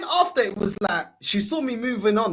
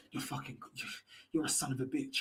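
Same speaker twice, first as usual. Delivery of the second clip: very soft, low mock menace; muttered insult; 8 semitones lower, 16 dB quieter than usual. Imagined partner turning on him out of calm, muttered abuse. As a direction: mutter it under your breath, acting the aggressor.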